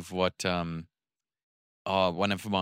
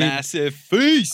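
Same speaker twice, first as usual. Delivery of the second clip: drawn out; 6 semitones higher, 10 dB louder, much slower than usual. Acted. Drawn-out correction.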